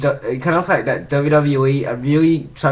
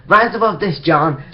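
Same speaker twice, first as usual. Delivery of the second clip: higher-pitched mock wrestler taunt; lightly acted, raised-voice challenge; retold taunt; 5 semitones higher than usual. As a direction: throw it out as a hammed-up jeer.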